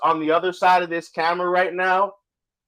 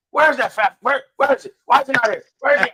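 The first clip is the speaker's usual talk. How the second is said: imitating a raised, higher-pitched old wrestling manager; mock angry demand; 7 semitones higher than usual. Wrestling manager demanding the missing dad, raised mock anger. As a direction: ham up the outrage, pitched well up. Lou demanding where Big Dog is.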